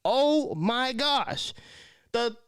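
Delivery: high, slow exasperated whine. Retold or imitated. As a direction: whine it up high like you're fed up. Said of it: "And I said".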